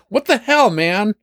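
very high, squeaky outrage; a splattered stranger protesting; indignant NPC. Acted. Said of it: "it's just like".

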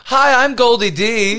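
slow, bright show-host opener; podcast intro voice. Acted. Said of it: "we're supposed to say it together".

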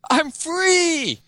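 high, strained cry of escape; mock shout. Wall breaking loose from the game, mock shout. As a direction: shout it high, without much extra volume. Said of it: "with the wall shouting".

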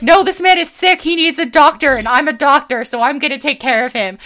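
mock earnest good-guy concern; earnest doctor Dan. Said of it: "he's like".

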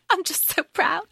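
high, soft, gushing proud voice; proud dragon. Retold or imitated. The dragon gushing over Violet, a soft, high, doting voice. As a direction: coo it like a doting parent. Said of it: "And Taryn's like".

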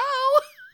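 big shrieking laugh; loud high cackle. Real, not acted. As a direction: burst out cackling. Laughing at own joke.

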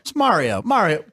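"Mario" with an open "ah", MAR-ee-oh; corrected pronunciation. Shown as a demonstration. Host insisting on the right name, "Mario" said MAH-ree-oh. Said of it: "[name], how's it pronounced?".

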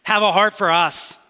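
imitating people crying out for help; retold cry for help. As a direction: call out over a crowd, half in earnest. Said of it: "a chaotic scene where people are saying".

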